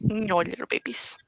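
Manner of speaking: soft breathy whisper aside. Real, not acted. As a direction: murmur it under your breath.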